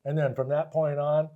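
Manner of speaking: ordinary talk, nothing marked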